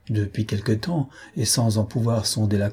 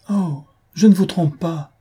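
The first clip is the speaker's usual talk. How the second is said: higher, louder plea, leaning on "ne"; woman begging her former master to believe her, desperate higher plea; desperate protest; 7 semitones higher, 4 dB louder than usual. A narrator's reading. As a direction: protest with all her heart that she isn't lying. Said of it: "reprit-elle".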